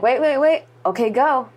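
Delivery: a stage hand holding you back; urgent cue. Retold or imitated. Crew holding her then sending.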